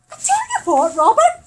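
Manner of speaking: sudden high nervous exclamation; high alarmed blurt. Retold or imitated. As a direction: pitch shoots up, voice loud and tight. Tense reply to death threat.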